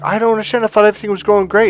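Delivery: high, fast confused whine; bewildered man. Retold or imitated. Dumped man baffled by his breakup, high fast whine. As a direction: rush it out, baffled. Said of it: "he's like".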